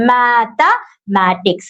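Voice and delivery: mathematics drawn out very slowly, syllable by syllable; slow syllable demo. Shown as a demonstration. Teacher showing students the syllables, very slow. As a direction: stretch out mathematics so each syllable is heard. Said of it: "എത്ര syllable ആണുള്ളത്".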